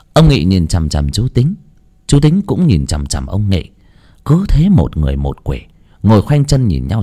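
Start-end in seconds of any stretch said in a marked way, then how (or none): none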